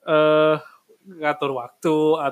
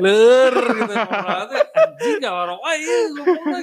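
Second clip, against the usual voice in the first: high, giggling through the words; laughing; 10 semitones higher, 5 dB louder than usual. Real, not acted. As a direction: crack up while explaining. Laughing while explaining the name.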